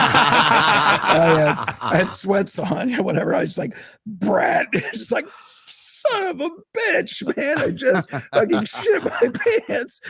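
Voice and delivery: can barely get it out for laughing; hysterical laughing. Real, not acted. Telling the podcast about shitting his pants, laughing high. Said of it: "I'm just laughing hysterically".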